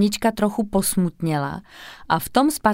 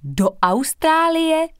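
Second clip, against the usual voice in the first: much higher, slow awestruck cry; amazed chorus; 9 semitones higher, 3 dB louder, slower than usual. A narrator's reading. Three classmates amazed at the news, high awestruck question. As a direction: gasp it out in wonder, all together. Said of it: "pronesly všechny tři spolužačky v úžasu".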